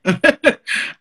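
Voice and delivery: a high, loud burst of real laughter. Real, not acted. Laughing loudly as cousin asks about her aging body.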